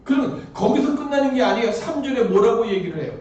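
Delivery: ordinary talk, nothing marked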